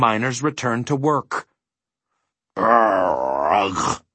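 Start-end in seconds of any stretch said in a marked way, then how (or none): 2.5-4.0 long, drawn-out groaning gasp; anguished gasp. A narrator's reading. A starving bug to his companions, gasping. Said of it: "gasped the bug".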